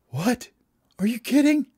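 high, rising disbelief; acted amazement at good news; astonished. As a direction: exclaim it high, as a stunned little bird. Birds react to offered ride.

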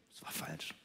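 flat whisper of disappointment. Acted. Disappointed person admits the mistake in a whisper. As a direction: drop to a bare whisper. Whispered verdict after hope.

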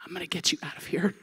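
low, hushed, quick promise; understated, soft heroic vow; quiet resolve. Retold or imitated. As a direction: imitate a cool young rescuer. Niece voiced as calm rescuer.

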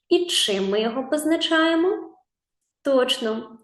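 normal talking voice

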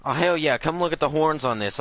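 excited. Real, not acted. Excited over big buck.

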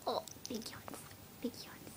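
soft breathy whisper. Real, not acted. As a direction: whisper gently to a sleepy pup. Whispering to sleepy puppy.

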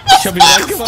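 very high, loud squeal; excited shout. Real, not acted. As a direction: shriek it out in real excitement. Jubelt über eigenes Tor. (schrilles, lautes Kreischen vor Aufregung)

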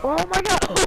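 loud, high excited outburst. Real, not acted. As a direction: yell in delight. Mutual kill in game.

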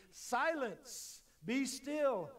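high, loud command to the storm; commanding rebuke. Acted. Jesus rebuking the wind and sea, commanding. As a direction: order the sea to be silent.

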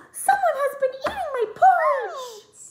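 very high, loud, shocked cry; shocked Mama Bear. A narrator's reading. Mama Bear cries out that her porridge was eaten. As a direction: squeal it out, outraged. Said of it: "Mama Bear gasped".

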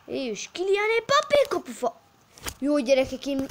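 high, loud goal call; excited hungarian sports commentary. Acted. Commentary then post hit.

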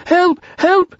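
very high, loud squeal for help; panicked cry. A narrator's reading. Piglet fleeing the Heffalump, high panicked cry. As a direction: squeal in small-animal terror. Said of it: "cried Piglet".

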